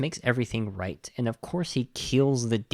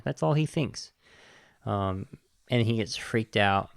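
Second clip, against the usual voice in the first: ordinary talk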